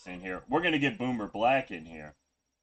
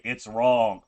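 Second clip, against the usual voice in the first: ordinary talk, nothing marked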